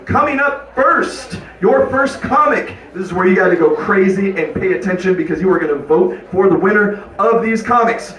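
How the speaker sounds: high, projected stage-host call; rally the crowd for the next comic; emcee announcing. Real, not acted. Host bringing up the first contest comic, rousing announcer voice.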